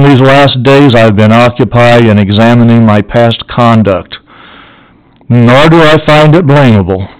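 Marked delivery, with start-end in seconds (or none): none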